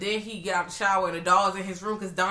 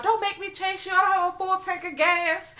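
mock cop threat; 13 semitones higher, 4 dB louder than usual. Quoting the cop's threat.

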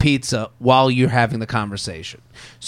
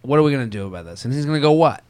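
calm, reasonable ask; 4 semitones lower, 4 dB quieter than usual. Retold or imitated. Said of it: "You go".

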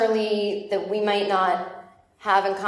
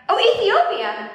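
drawn-out, higher dawning recognition; oh of relief at last getting it; sudden realization; 10 semitones higher, 5 dB louder, slower than usual. Real, not acted. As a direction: land the penny-drop slowly. Finally understands audience member's answer.

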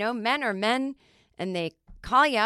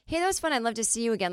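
friendly date invitation; 4 semitones higher, much faster than usual. Acted. Caller asking someone out again after a fun date, friendly and high. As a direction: ask them out again in a higher voice. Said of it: "you can hear the tone".

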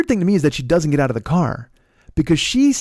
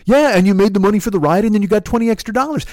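high, fast mimicked arguing; heated comeback; 7 semitones higher, 4 dB louder, faster than usual. Passenger justifying the twenty dollars to driver, heated comeback. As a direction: talk back quickly at raised pitch. Passenger argues back in quarrel.

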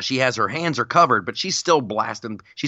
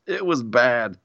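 ordinary talk, nothing marked

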